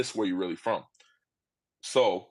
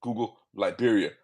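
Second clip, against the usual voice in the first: normal talking voice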